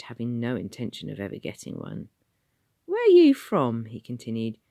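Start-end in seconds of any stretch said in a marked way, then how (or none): 2.9-3.9 much higher, slow, loud London voice; a cheeky city official quizzing a stranger; high Cockney ticket man. Acted. Ticket man questioning Tom, high and loud.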